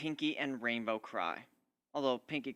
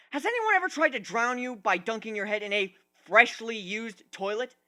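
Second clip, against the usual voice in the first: higher, louder run of angry questions; bitter rant; 7 semitones higher, 6 dB louder than usual. Acted. Griffin telling the ponies how hard life outside is, heated. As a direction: pound each question at them.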